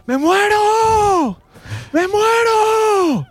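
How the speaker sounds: hammed-up agonised shout of a friend with kidney pain; mock screaming in pain. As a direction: howl it slowly like you're dying. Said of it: "empezamos a escuchar".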